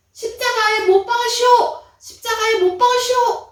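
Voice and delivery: high, loud mob chant; angry crowd demanding the crucifixion, shouted high; crowd shouting. Acted. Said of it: "그러면서 계속적으로".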